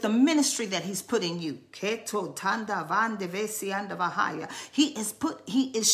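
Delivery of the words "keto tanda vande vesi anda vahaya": softer murmured tongues; speaking in tongues. Real, not acted. Preacher praying in tongues over the ministry in them. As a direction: drop into quiet tongues.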